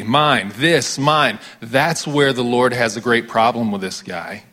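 ordinary delivery

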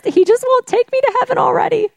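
very high, fast imitation wail; hammed-up old mother complaining in tears; mock sobbing. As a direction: whine it rapidly, way up high. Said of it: "she's sobbing".

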